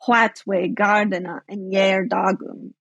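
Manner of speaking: slow recital of What we gardena in yer dagum with strong beats on the alliterating syllables; Old English recitation. Shown as a demonstration. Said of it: "it would sound something like".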